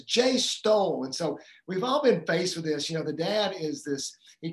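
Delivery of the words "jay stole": higher, louder and slow, a small daughter telling on her brother; little girl tattling. Retold or imitated. Daughter greets dad at the door with news, higher, louder, slow tattle. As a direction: drag it out like a kid reporting a crime. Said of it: "He says".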